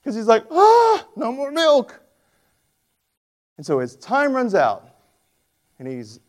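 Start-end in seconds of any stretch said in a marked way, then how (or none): 0.5-2.0 high cracked cry of mock despair; mock-tragic high whine. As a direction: wail it like a hammed-up tragedy. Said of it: "it's so tragic".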